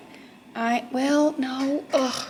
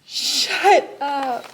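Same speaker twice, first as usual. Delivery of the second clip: excited; 4 semitones higher, 7 dB louder than usual. Real, not acted. Opening Secret Santa gift.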